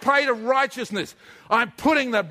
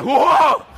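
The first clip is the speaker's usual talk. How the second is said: whoa exclamation; 6 semitones higher, 8 dB louder than usual. Acted. Lifting the big shield.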